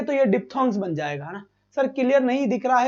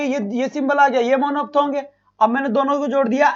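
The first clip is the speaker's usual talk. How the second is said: usual speaking voice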